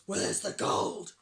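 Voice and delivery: gruff, hoarse pirate shout; Hank Chief. A narrator's reading. Pirate chief demands his gold, gruff slow shout. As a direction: growl it slowly and loudly. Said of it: "shouted Hank Chief".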